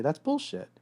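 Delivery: mock the guy arguing back; arguing boyfriend. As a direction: boyfriend snapping back at his girlfriend. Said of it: "argue and say".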